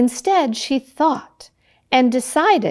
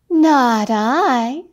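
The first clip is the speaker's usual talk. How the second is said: cat refusing; 3 semitones higher than usual. Acted. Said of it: "said the cat".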